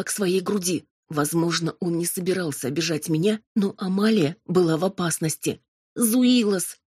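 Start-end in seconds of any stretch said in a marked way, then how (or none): 5.9-6.8 high urgent cry of a name; piercing cry. A narrator's reading. Robin stopping her demon, piercing cry. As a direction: cry out sharply to stop him. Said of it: "пронзительно вскрикнула я".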